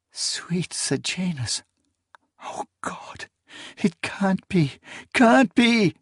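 rising panic from a hush to a cry; horrified disbelief. A narrator's reading. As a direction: gasp it out, then break into a cry. Character in horror at creature.

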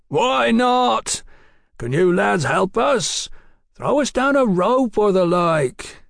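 high, hearty shouted request; calling up. A narrator's reading. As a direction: call up to the lads for help. Calling up for a rope.

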